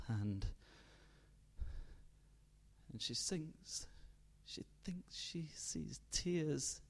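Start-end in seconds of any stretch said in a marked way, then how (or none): 4.5-6.8 slow, hushed, breathy narration; fading whisper. A narrator's reading. Narrator whispering as the mother sinks. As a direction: let it fade as she sinks.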